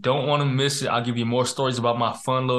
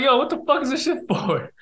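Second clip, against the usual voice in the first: mock bewildered; 11 semitones higher than usual. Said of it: "I'm like".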